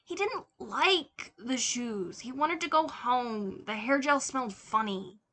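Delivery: a hint of a sulky small child complaining; whiny little toddler. A narrator's reading. Tim's inner toddler grumbling to himself, lightly whiny. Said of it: "there was a whiny little toddler".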